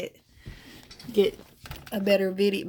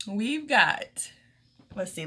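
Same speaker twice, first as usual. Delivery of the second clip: ordinary talk, nothing marked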